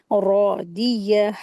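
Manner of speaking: रॉ दिया with a heavy, full रा. Shown as a demonstration. Teacher reciting रॉ दिया for students, heavy रा. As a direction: open रॉ heavy and round. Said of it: "ऐसे पढ़ेंगे".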